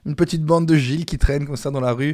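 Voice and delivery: ordinary delivery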